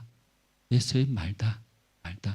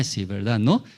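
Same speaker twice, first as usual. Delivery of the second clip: usual speaking voice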